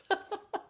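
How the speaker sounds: short high-pitched giggle. Real, not acted. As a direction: burst of amused laughter. Amused by comment on accent.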